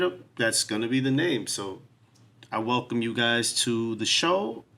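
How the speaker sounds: ordinary talk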